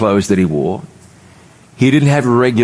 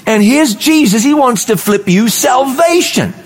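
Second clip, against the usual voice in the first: fiery preaching; 9 semitones higher than usual. Acted. Preacher rousing the congregation to take salvation, at full force.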